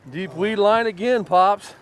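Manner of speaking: fighting a fish; calling out mid-fight; excited. Real, not acted. Another fish on, calling to Pops.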